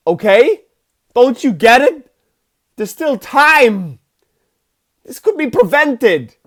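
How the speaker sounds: loud, high, desperate pleading; Christopher Walken. Retold or imitated. Psychic begging the mother to act, mock desperation. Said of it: "He's like".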